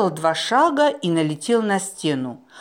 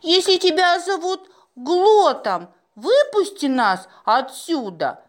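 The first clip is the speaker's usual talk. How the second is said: stern; 11 semitones higher than usual. A narrator's reading. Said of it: "строго сказала Маруся".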